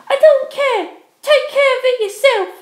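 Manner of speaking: high, loud and cutting; angry dismissal. Acted. Penelope dismisses begging ex, high and cutting. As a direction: send him away in contempt.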